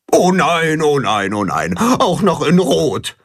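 a touch of gloomy goose moaning; dejected goose. A narrator's reading. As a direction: moan it with head hanging. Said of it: "lässt Gram seinen Kopf hängen".

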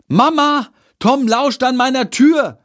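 high-pitched, indignant call; calling out loudly. A narrator's reading. Jendrik telling on Tom to his mother, calling out. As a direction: shout a complaint to another room. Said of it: "ruft er laut".

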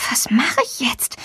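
soft whisper to herself; whispered thought. A narrator's reading. Girl stuck on the door thinks to herself, whispered. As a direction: breathe the question, voiceless. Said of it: "denkt sie".

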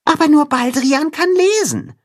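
little mole. A narrator's reading. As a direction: gently remind your friend. Mole reminds.

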